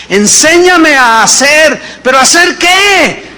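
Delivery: preaching shout. Real, not acted. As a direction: proclaim it to the whole church. Preaching peak on the psalm.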